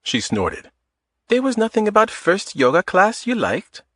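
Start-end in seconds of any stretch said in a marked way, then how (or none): 1.3-3.8 put-on higher woman's voice with a light Eastern European accent. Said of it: "In a light Eastern European accent".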